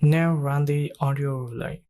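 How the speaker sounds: ordinary talk, nothing marked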